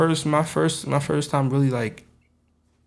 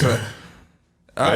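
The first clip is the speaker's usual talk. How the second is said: normal talking voice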